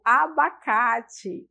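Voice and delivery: abacate, drawn out slowly; slow Portuguese model word. Shown as a demonstration. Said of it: "como é que eu escrevo aqui em inglês". (abacate dito devagar)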